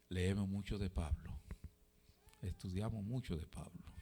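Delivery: very soft, low and measured, with long pauses; quiet, grave scripture reading; hushed sermon closing. Real, not acted. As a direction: let the voice sink into solemn reflection. Preacher's hushed closing and scripture citation.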